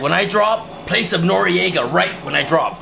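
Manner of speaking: imitating his own eager plea; mock excited demand. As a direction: pitch it up like an eager kid. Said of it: "I was like".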